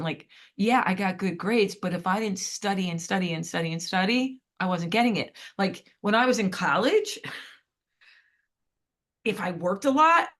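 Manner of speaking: usual speaking voice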